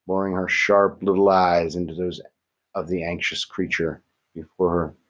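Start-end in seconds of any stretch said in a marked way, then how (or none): none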